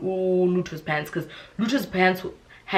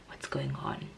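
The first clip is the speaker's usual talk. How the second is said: much lower, whispered question; 13 dB quieter than usual. Retold or imitated. Policeman in the van asking what happened, whispered. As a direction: murmur it low like a cop.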